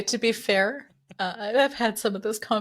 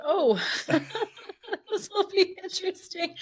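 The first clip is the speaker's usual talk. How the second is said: higher, laughing through the words; amused recalled reaction; 11 semitones higher than usual. Retold or imitated. Host recalling guest's odd bio, laughing. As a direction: giggle through a retold thought.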